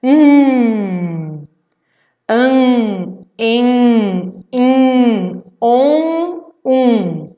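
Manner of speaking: an, en, in, on, um each held loud through the nose; nasal vowels. Shown as a demonstration. Teacher to pupils, drilling nasal syllables loudly. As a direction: sound out an, en, in, on, um nasally, one by one. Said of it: "sai o som pelo nariz".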